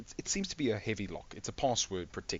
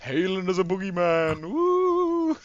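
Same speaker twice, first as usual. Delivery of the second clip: loud, high ghostly wail; mock spooky voice; 9 semitones higher, 7 dB louder, slower than usual.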